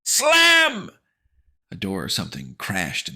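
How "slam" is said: high loud slam effect; slam sound. Acted. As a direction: bang it out.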